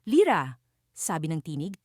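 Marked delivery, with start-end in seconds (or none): 0.0-0.6 louder, mildly angry call. A narrator's reading. Angry voice calls Lira from behind, mildly angry. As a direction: call the name with a touch of anger. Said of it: "isang tinig na puno ng galit at puot".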